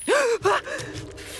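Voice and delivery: sharp frightened gasp; sudden shocked intake of breath. Acted. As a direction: gasp in horror at the slugs. Finds floor full of slugs.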